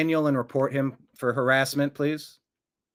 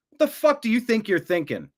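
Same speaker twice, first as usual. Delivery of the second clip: higher-pitched, exasperated demand; angry challenge; 5 semitones higher than usual. Real, not acted. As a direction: press the troll angrily for an answer. Host challenges troll for an answer.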